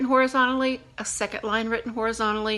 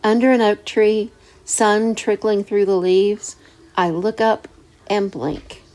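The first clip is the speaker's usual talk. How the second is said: louder, slow poem recital; poem reading; 7 dB louder, slower than usual. Shown as a demonstration. Teacher reciting her own haiku to students, poem reading. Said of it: "I wrote this to give you".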